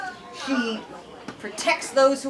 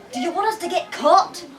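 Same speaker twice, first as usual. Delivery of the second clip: put-on growling village boy; 5 semitones higher than usual. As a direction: hiss at your friends to hurry. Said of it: "a boy growled".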